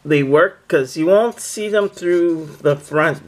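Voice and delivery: normal talking voice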